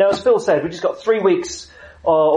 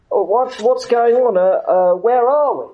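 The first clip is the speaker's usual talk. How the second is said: put-on a bewildered drunk sailor asking his mate; lightly hammed-up lost drunk; 9 semitones higher, 3 dB louder than usual. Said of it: "one turns to the other and says".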